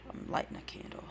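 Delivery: whispered aside; whispering. Real, not acted. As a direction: breathe it to yourself. Aside while lighting candle.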